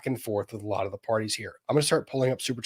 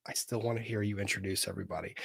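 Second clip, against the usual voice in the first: ordinary talk, nothing marked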